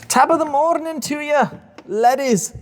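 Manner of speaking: loud, very high put-on Irish greeting; mock Irish accent. Joker greeting the ladies, loud mock Irish accent. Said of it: "That's my Irish accent".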